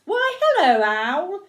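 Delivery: high, loud, swooping hello; cheery mouse greeting. A narrator's reading. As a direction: call out a big friendly storybook greeting. Mouse greets owl in story.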